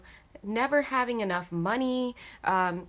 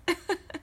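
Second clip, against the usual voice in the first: short high giggle; 10 semitones higher than usual. Real, not acted. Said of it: "sorry, I'm laughing because".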